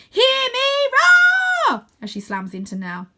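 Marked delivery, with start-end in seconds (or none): 0.1-1.8 high, very loud battle cry; drawn-out, full-voiced war cry; yell. A narrator's reading. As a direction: bellow it as you charge in. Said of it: "I notice Flora yells".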